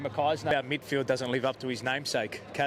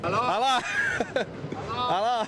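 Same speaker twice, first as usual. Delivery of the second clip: mock hello; 6 semitones higher than usual. Real, not acted. Mock calling out, laughing.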